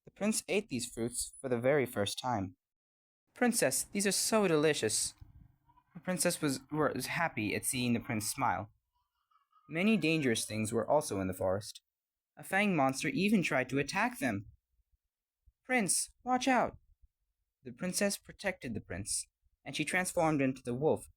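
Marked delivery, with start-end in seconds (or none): 3.3-5.1 high-pitched delight; delighted prince. A narrator's reading. Prince delighted by new fruit. 15.6-16.8 high, drawn-out cry of alarm; alarmed warning. A narrator's reading. Princess warns of monster attack.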